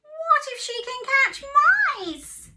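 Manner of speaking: anxious farm cat. Acted. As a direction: squeak it out as a worried cat. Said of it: "said Cat".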